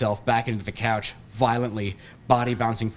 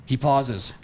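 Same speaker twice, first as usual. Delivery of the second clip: plain reading voice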